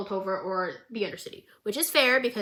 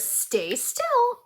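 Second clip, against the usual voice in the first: much higher, eerie singsong; creepy sing-song; 12 semitones higher than usual. Retold or imitated. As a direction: coo it sweetly while stabbing. Jinx stabbing, voiced.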